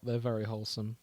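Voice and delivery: normal talking voice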